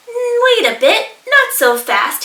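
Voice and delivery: bristling echidna. Acted. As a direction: stop them short, bristling. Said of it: "he bristled".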